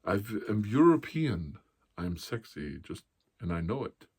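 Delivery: lightly done mock Austrian accent, loud start; a hint of Arnold's Austrian swagger. Comic imitating Arnold's boast, light Austrian accent. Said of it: "he's like".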